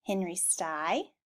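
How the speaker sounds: ordinary delivery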